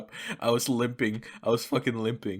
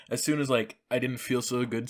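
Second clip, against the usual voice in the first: ordinary talk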